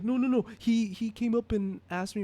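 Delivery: high, flustered imitation of an upset man; emotional protest. Man defending himself to his angry brother, emotional protest. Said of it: "really hard to hold his emotion".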